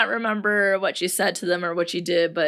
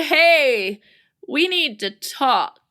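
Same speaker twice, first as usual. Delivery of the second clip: ominous opener; 6 semitones higher, 4 dB louder, much slower than usual. Shown as a demonstration. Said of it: "we probably should not say".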